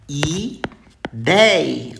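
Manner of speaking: Ideia said loud with a wide open éi; open-vowel demo. Shown as a demonstration. Teacher showing the open sound in Ideia, loudly. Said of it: "é aberto o som".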